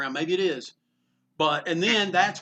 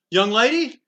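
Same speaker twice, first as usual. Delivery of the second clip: calling out; 6 semitones higher, 8 dB louder than usual. Retold or imitated. Eisner calling out to vendor.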